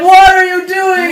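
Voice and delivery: high, drawn-out yell of protest; hammed-up panicked shout; alarmed shout. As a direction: yell it over the take-off. Child protests as ship takes off.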